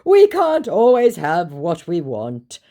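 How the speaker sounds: high, slow, loud mock lamenting; hammed-up resignation, imitating him. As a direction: draw it out like a tragic suitor. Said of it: "he laments".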